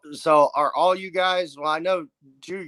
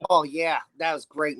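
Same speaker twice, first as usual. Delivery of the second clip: ordinary talk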